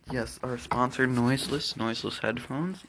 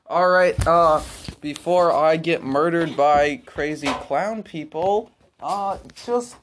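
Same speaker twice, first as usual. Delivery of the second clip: loud sign-off; 11 dB louder than usual. Acted. Host signs off loudly.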